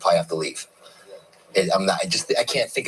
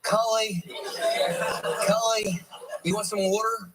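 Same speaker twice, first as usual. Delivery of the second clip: high-pitched South Jersey imitation; South Jersey accent; 9 semitones higher than usual. Husband teasing wife about her hometown, high South Jersey accent. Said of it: "when I go down to South Jersey".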